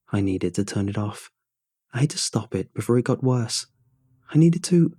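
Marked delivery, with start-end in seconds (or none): 4.3-4.9 higher, urgent and cut short; rising panic. A narrator's reading. Narrator desperate to stop the awful music, panicked. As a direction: break off with mounting desperation.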